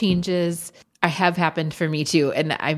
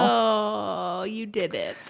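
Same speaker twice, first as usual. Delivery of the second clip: teary; 3 semitones higher, much slower than usual. Real, not acted. Touched by past Kelsey's message.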